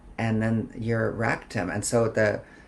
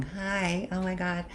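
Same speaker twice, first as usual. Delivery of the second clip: put-on high, girlish naive voice; playing dumb and young; 8 semitones higher, 4 dB quieter than usual. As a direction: act wide-eyed and innocent. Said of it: "played, like, all dumb and young".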